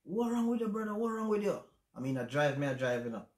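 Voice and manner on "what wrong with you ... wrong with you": high, louder, strained imitation of a crying brother; distressed brother. Brother calling in tears, begging to know what's wrong, distressed. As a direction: plead like the panicked brother. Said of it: "he's like".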